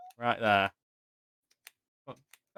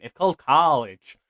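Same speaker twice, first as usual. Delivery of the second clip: put-on American accent on college; mock American pronunciation; 3 semitones higher, 4 dB louder than usual. Shown as a demonstration. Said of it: "I didn't say that with an American accent".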